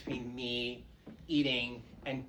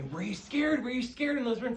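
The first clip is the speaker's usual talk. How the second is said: high, teasing voice asking if she was scared; mocking the askers; 11 semitones higher, 6 dB louder than usual. Comic asking people. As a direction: mimics nosy fans quizzing her, high and teasing. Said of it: "people always said to me".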